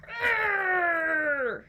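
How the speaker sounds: loud high frustrated roar; angry growl. Shown as a demonstration. Showing the maddest face, loud angry roar. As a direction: let out a big mad arr. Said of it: "really, really mad face".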